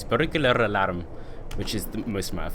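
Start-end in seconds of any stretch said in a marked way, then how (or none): 0.0-1.0 slow Scottish burr on the phrase; amateur Scottish accent, savoured slowly. Shown as a demonstration. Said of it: "the best I can do in a Scottish accent".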